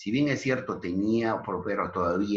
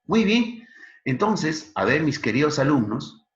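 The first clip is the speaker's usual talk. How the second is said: energetic teacher call; 9 semitones higher, 7 dB louder than usual. Real, not acted. Teacher rallying her students.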